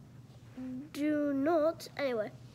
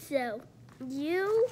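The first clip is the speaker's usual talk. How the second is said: usual speaking voice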